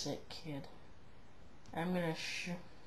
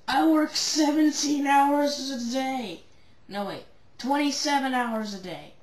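exasperated shout; 6 semitones higher, 9 dB louder than usual. Acted. Character complaining loudly.

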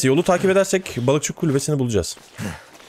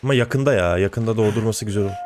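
ordinary talk

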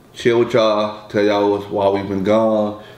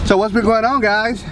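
upbeat vlog greeting; 11 semitones higher than usual. Real, not acted.

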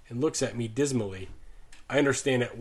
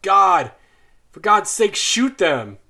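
loud, high cry of anguish; a farmer begging someone to end the suffering; anguished shout; 7 semitones higher, 10 dB louder, slower than usual. A narrator's reading. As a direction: yell it out in horror at the horses' screaming. Dietering cries out over horses.

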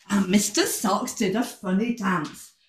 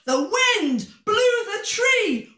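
high-pitched, dramatic storytelling; excited narration; 9 semitones higher than usual. A narrator's reading. Storyteller as the wind hits the tree, excited and high.

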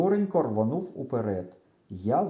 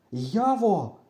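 higher, strained name; nearly breathless call; 7 semitones higher, 3 dB louder than usual. A narrator's reading. Boy calling his silent friend, nearly breathless. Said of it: "майже задихаючись".

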